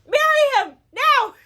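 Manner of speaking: high, loud fangirl squeal; gushing excitement. Retold or imitated. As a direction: squeal at the book like a superfan. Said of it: "I was like".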